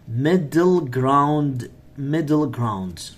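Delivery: "Middle ground" drawn out slowly, word by word; slow idiom drill. Shown as a demonstration. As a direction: stretch each syllable of "Middle ground" for learners. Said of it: "this is important idiom".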